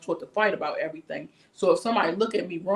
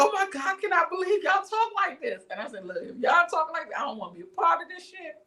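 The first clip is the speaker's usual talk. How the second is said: higher-pitched appalled outburst; shocked disbelief; 10 semitones higher than usual. Retold or imitated. Reacting to a threatening client from D C, appalled disbelief. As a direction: sound scandalized by how they talk.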